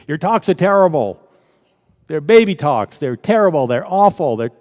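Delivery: hammed-up scornful complaint; throwing abuse. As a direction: imitate a critic hurling insults. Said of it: "she just kept throwing this abuse".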